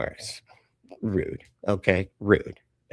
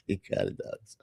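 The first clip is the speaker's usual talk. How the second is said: whispered aside; 10 dB quieter than usual. Real, not acted. Whispered repeat of agreement.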